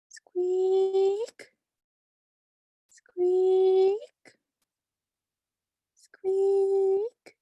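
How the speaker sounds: high squeak like a cart wheel; squeaky wheel noise. Retold or imitated.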